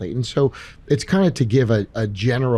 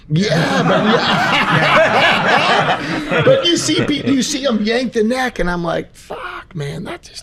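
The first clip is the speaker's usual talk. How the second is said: laughing; 12 semitones higher, 5 dB louder, much slower than usual. Real, not acted. Laughing about patients' necks being yanked.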